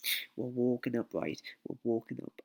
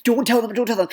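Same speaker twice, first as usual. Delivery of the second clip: high, loud pleading from the mates; urgent plea; 10 semitones higher, 7 dB louder than usual. Retold or imitated. Mates urging him not to tell the girls, high anxious pleading. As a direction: beg him not to spill it.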